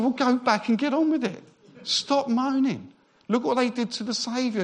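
high-pitched, emphatic telling-off; exasperated retort, pitched well up; mock scolding.

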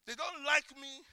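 ordinary talk, nothing marked

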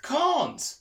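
out of breath, high and gasped; panting. Acted. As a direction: gasp it out breathless. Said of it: "panted Smithy".

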